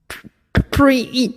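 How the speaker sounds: put-on frightened old priest stuttering; stammering, bubbling. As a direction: choke on the first sounds, can't get it out. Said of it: "he was bubbling now, unable to get his words out".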